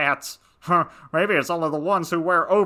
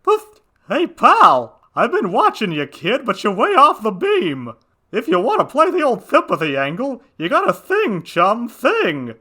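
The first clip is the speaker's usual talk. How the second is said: high cat voice; 5 semitones higher, 4 dB louder than usual. Acted. Cat character voice throughout.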